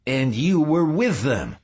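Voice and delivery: high, loud shocked accusation; sudden cry. A narrator's reading. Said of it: "he cried suddenly".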